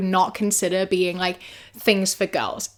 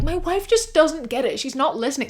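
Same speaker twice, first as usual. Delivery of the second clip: mocking the husband's whine; 5 semitones higher, 3 dB quieter than usual.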